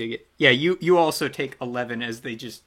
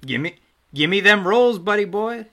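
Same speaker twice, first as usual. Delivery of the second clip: playful demand; 7 semitones higher, 8 dB louder than usual. Acted. GM demanding the dice rolls.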